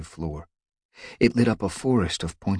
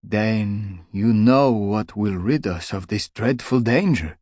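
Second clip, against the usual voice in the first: slow, higher, unsure question; hesitant; 4 semitones higher, 6 dB louder than usual. Acted. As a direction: ask haltingly, afraid of the answer. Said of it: "mewed hesitantly".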